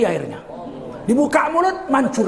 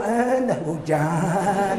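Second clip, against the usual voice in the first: mock complaining; 3 dB quieter, much slower than usual. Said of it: "Hujan ngeluh".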